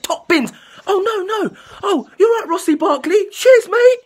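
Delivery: high-pitched sarcastic match run-through; mock Sarri ball play-by-play. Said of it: "this is an example of Sarri ball".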